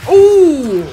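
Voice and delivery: loud high ooh; sudden shocked yelp; startled yelp. Real, not acted. Startled by enemy.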